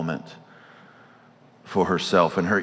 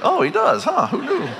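mock audience surprise. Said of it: "some of you are like".

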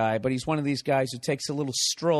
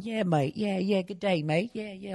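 mock English accent; 7 semitones higher than usual. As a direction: ham up a chatty English guy talking on his cell. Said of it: "So he sounds like he's in, you know, Lock, Stock".

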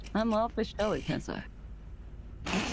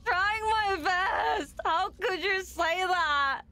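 mock crying; 10 semitones higher, slower than usual. To her teasing partner, a hammed-up tearful wail.

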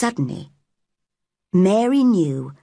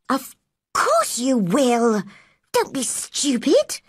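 crossly; 5 semitones higher, slower than usual. Acted. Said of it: "said Mary crossly".

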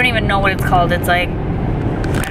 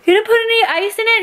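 higher, faster mock shock; mock surprise; 12 semitones higher, faster than usual. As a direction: pretend you can't believe it, quickly. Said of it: "now I'm gonna be like".

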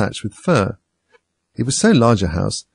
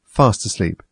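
plain reading voice

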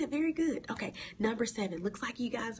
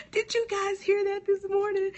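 much higher, louder thrilled question; excited calling out; 12 semitones higher, 6 dB louder than usual. Real, not acted. Preacher asking her viewers if they heard it. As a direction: call to the viewers, elated.

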